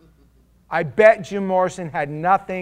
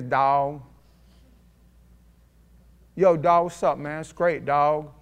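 a hint of a mock street-slang greeting, lightly voiced. Preacher lightly mocking kids' slang greeting, lightly voiced. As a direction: lightly imitate kids greeting each other in slang. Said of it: "who now talk like this".

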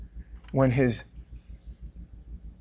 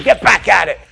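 gruff father's push; 8 semitones higher, 9 dB louder than usual. Acted. God voiced roughhousing his sons.

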